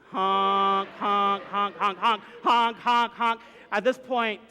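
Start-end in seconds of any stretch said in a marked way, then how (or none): 0.1-3.4 insistent, punched horn blasts; imitated car horn. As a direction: honk repeatedly like an impatient driver. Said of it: "Starts honking".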